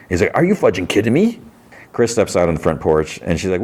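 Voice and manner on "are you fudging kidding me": higher-pitched mock disbelief; mock incredulous. Answering a coworker's teasing nickname, mock incredulous. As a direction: retell an exasperated comeback. Retells exasperated reply to teasing.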